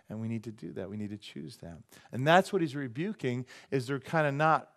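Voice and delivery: ordinary delivery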